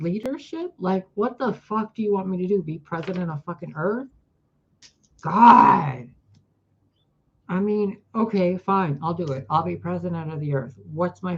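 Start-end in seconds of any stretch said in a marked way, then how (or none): 5.2-6.1 loud strained cry of "God"; anguished groan. Real, not acted. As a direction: let the exasperation burst out loud. Exasperated outcry.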